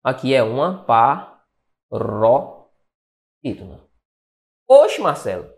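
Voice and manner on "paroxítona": "paroxítona" very slow, pa, ro, xítona with pauses; broken-up word. Shown as a demonstration. Teacher spelling out the rule paroxítona, slowly broken up. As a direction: say "paroxítona" in pieces, as if writing it.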